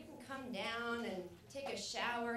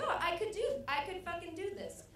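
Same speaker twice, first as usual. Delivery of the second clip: higher-pitched eager realization; acted burst of can-do excitement; dawning confidence; 7 semitones higher, 3 dB louder than usual. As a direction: talk yourself into it, suddenly keen. Acts out sudden murderous confidence.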